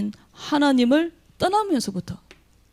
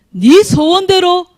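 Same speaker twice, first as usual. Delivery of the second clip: solemn declaration; 7 semitones higher, 8 dB louder, slower than usual. Acted. Jesus' words quoted.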